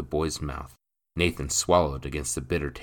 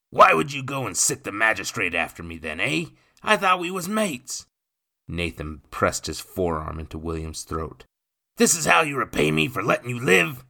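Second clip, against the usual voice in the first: loud, higher enraged cockney; angry cockney; 7 semitones higher, 6 dB louder than usual. Acted. Nathan to William pinned at the wall, angry cockney. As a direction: choke the words at a traitor.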